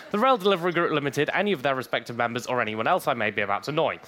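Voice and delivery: fast, rattled-off fine print; rapid legal disclaimer. Acted. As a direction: rush through it like small print. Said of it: "things I have to say to stop myself getting in trouble".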